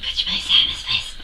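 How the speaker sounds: whispered, starstruck blurting; hushed mock-timid request; shy whisper. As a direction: retell it in an awkward whisper. Retelling shy request to her idol.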